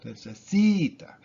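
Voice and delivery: ne-ce-SI-ta, third syllable lifted and stretched; stress on SI. Shown as a demonstration. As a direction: hit the si hard in necesita. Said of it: "It's the C".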